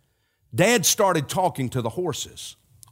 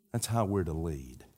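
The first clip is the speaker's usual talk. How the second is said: earnest closing appeal; 6 semitones lower, 6 dB quieter, slower than usual. Acted. Slow soft closing exhortation.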